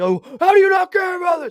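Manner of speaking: hammed-up high, loud holler; imitated yelling. Host mimicking co-host mad at him for not caring, mock yelling. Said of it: "you yell at me".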